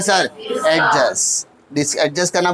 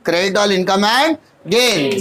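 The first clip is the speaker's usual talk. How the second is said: slow, deliberate rule recital; much slower than usual. Shown as a demonstration. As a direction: recite the rule slowly for memory. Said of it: "nominal account". (नियम धीरे-धीरे दोहराते हुए)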